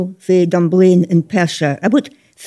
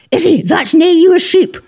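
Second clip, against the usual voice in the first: imitating Scottish dad; 8 semitones higher than usual. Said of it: "I just heard Richie saying".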